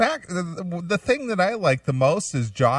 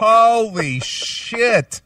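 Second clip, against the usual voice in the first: stunned; 9 semitones higher, 6 dB louder, much slower than usual. Real, not acted. Shocked reaction.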